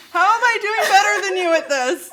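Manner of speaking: laughing through pain, high and loud; half-laughing, half-crying. Real, not acted. Laughing while eating hot sauce.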